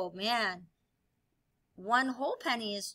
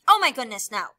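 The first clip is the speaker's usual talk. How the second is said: mock dismay; 9 dB louder, faster than usual. Teacher feigns shock at wrong measuring.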